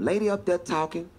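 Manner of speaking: ordinary talk, nothing marked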